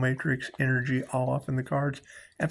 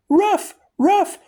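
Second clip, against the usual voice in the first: very high, louder yapping barks; dog bark; 6 dB louder than usual. Retold or imitated. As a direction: yap like a small dog. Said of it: "Imagine a bark".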